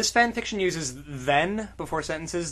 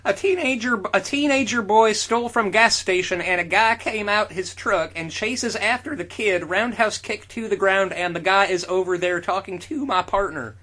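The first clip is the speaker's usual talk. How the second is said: higher, louder cop report; officer voice; 5 semitones higher, 4 dB louder than usual. Acted.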